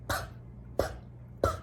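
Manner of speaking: voiceless little puffs of air; puffing P sound. Shown as a demonstration. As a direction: pop the lips on each "puh". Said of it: "like a puffing sound".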